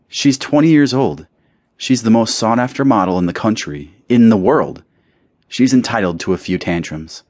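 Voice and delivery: lightly voiced indulgent mother waving it away; a hint of a languid society mother; dismissive mother. Constantine defends her daughter.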